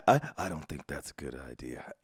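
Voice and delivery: low, fast, quiet mock voice; mocking deep voice. Streamer mocks her line.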